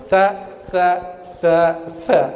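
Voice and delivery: THA repeated in short separate bursts, tongue tip out; isolated repeated tha; practice drill. Shown as a demonstration. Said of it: "Sambil jalan dari masjid ke rumah".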